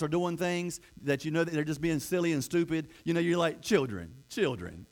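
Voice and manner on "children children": low, drawn-out mock scolding; a parent's low teasing tut. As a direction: chide them like silly kids, deep and slow. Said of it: "you're just picking at them".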